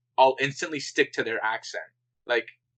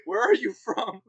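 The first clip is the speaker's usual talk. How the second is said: baffled stranger; 10 semitones higher than usual. Retold or imitated. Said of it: "people are like".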